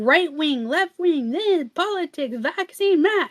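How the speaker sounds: high, sing-song bickering; mock squabbling. Mocking little people fighting over politics, squabbling.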